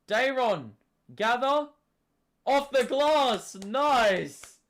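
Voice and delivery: loud high excited call; excited shouting. Real, not acted. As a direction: call the play out hyped. Cheering a made basket.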